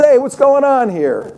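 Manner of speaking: lightly voiced Trump, asking what's up. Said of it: "this guy taps me on the shoulder and says".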